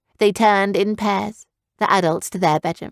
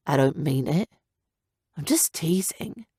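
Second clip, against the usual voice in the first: soft, hurt whisper; 3 semitones lower, 5 dB quieter than usual. A narrator's reading. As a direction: say it quietly, stung. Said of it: "Scout said in a hurt tone".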